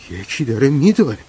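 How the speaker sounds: high, loud cry of alarm; startled, frightened shout; terrified. A narrator's reading. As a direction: blurt it out in panic, pitch leaping up.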